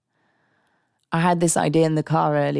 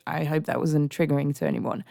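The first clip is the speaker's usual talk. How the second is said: ordinary talk, nothing marked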